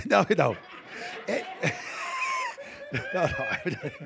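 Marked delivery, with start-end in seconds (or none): 1.3-3.9 laughing confession. Real, not acted. Repeating the joke, laughing.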